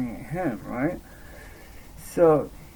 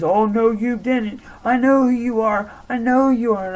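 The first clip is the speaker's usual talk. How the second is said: high, loud panicked accusation; retold frantic outburst, pitched up and loud; imitated freak-out; 9 semitones higher, 8 dB louder than usual. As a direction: act out a freaked-out kid accusing someone. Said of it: "he's freaking the fuck out".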